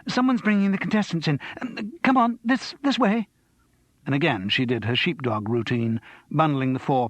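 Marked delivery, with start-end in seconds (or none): 0.1-3.3 quick, high and flustered; flustered urgency. A narrator's reading. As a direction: hurry them along in a panic. Sydney panicking, herding people.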